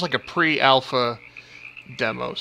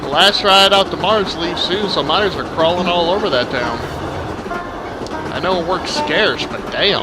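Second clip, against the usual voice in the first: put-on southern accent. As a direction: lay the Southern accent on thick. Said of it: "exaggerate my already southern accent".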